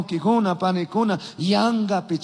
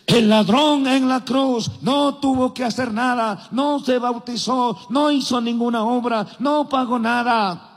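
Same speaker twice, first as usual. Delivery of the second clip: quoting other preachers; 5 semitones higher than usual. Retold or imitated. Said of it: "algunos predicadores dicen".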